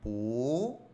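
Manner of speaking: "pu" drawn out and rising; second-tone bu. Shown as a demonstration. Teacher showing the changed tone, a long rising "pu". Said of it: "ahora es".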